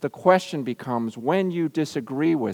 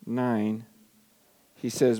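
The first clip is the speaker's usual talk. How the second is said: ordinary talk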